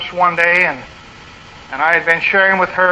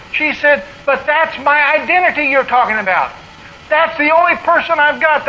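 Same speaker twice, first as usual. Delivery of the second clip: voicing the distressed lady; 7 semitones higher than usual. Pastor voices distressed counselee.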